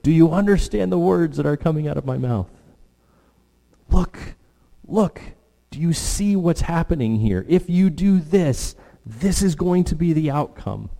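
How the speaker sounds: put-on high, strained look-look warning to a child; exasperated parent scolding. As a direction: scold the kid, and squeeze every word out. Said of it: "Has anybody ever said that to a kid?".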